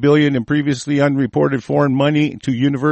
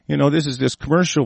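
usual speaking voice

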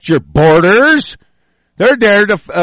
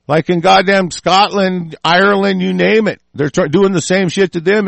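usual speaking voice

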